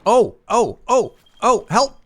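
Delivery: high, loud panicky yelps; fluttering cries for help. Real, not acted. Attacked by bugs, calling help.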